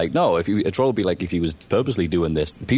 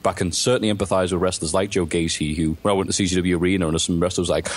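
ordinary delivery

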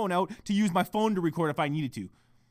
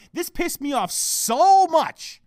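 drawn-out, louder SO MUCH; exasperated stress; 7 semitones higher, 4 dB louder, much slower than usual. Real, not acted. Podcaster ranting at listeners about a switched topic, exasperated stress. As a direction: hit "so much" hard and stretch it.

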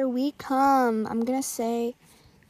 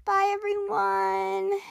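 sad goodbye; 9 semitones higher, much slower than usual. Real, not acted. Waving goodbye after elimination.

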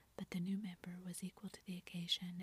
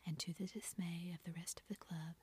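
plain reading voice

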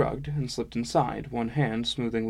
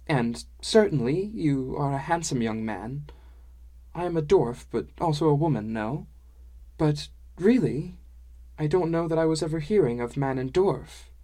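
higher, lilting dwarf-woman voice; higher-pitched dwarf woman, hesitantly explaining; tactful dwarf woman; 5 semitones higher than usual. Acted. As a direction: let him down gently in a lilting character voice.

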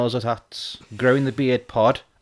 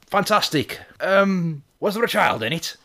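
high, loud put-on farmer voice; imitated farmer, loud then hesitantly asking; mad farmer; 11 semitones higher, 4 dB louder than usual. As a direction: play the farmer, pitched right up. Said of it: "And he's like".

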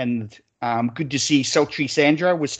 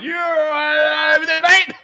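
high, drawn-out shouted jeer; mock shout; 5 dB louder, much slower than usual. Blokes at the betting table jeering at the smell, loud mock shout. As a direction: yell it across the room like blokes teasing a mate. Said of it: "they're all going".

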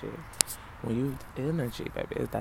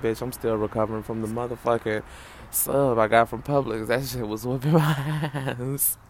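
loud complaint; 5 dB louder than usual. Real, not acted. Complaining about a bad sandwich.

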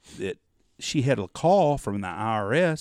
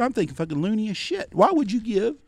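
high, rising, incredulous rant; exasperated; 10 semitones higher, 4 dB louder than usual. Retold or imitated. Son berating his mom for giving out money, exasperated. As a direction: demand an answer in high-pitched disbelief.

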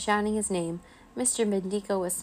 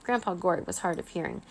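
usual speaking voice

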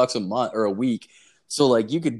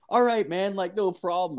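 put-on higher, polite young missionary voice; mimicking the Mormons; 7 semitones higher than usual. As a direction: play the unfazed missionary, pitched up. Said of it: "They're like".

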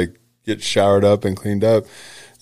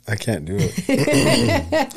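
laughing; much slower than usual. Real, not acted. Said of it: "I can't get that high".

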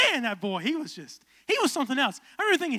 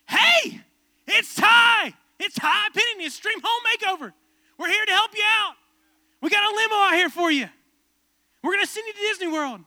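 high, loud hyped host voice; Ty Pennington impression; 10 semitones higher, 8 dB louder than usual. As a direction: shout it like an over-excited TV host. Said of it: "and be like".

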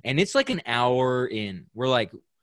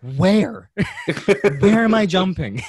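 yelling back laughing; 7 semitones higher, 6 dB louder than usual. Retold or imitated. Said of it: "I yell back".

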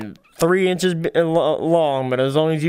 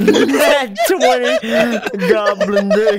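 high, slow, laughing repeat; laughing echo; slower than usual. Real, not acted. Friend echoing the goblin chant, laughing. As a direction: laugh it back high and drawn out.

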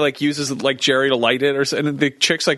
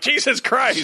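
shocked; 10 semitones higher, slower than usual. Retold or imitated. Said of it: "We're like".

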